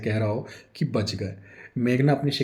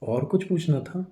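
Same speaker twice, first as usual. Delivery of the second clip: slow, higher innocent question; innocently; 6 semitones higher than usual. A narrator's reading. Husband feigning innocence to angry wife, slow and sweet. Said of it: "बड़ी मासूमियत से बोलता है".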